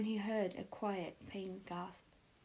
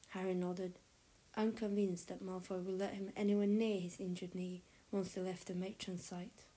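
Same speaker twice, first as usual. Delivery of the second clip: ordinary delivery, nothing marked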